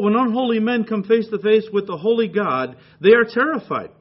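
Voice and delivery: loud preaching read of a quote; reading a commentator's words aloud, preaching loud. Retold or imitated. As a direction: proclaim the quoted passage with force. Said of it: "He says, and I quote".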